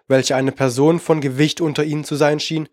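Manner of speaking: usual speaking voice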